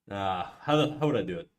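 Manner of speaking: ordinary talk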